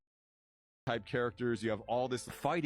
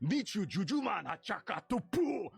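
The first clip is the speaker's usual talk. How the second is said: higher, barked alien words; Huttese gibberish; 10 semitones higher than usual. Shown as a demonstration. Extra showing off his Huttese lines, in alien gibberish. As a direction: shout it like a Gamorrean gamble. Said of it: "I'm gonna learn Huttese".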